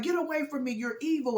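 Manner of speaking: high-pitched retold protest; alarmed rebuff. Mom recoils from Dallas grabbing her.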